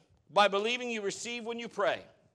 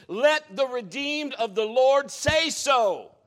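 emphatic proclamation; 5 semitones higher, 6 dB louder, slower than usual. Real, not acted. Preaching emphasis.

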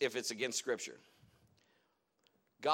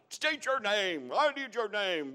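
slow, louder, insistent order; stern command; 6 semitones higher, 5 dB louder, slower than usual. Retold or imitated. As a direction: demand the name firmly. Commanding demon to give name.

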